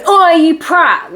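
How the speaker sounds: mock cockney insult. Mock modern insult quote.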